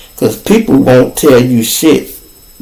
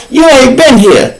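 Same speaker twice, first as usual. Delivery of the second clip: retold heated insist; 9 semitones higher than usual. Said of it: "I'm like".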